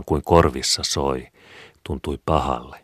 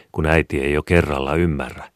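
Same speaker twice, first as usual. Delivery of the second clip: usual speaking voice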